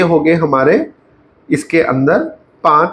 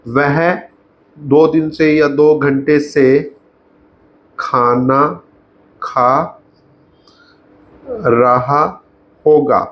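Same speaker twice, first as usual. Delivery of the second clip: very slow, broken by long pauses; slow dictation; much slower than usual. Shown as a demonstration. Teacher writing out an example sentence, slow dictation. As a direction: say it piece by piece as if writing. Saying words while writing them out.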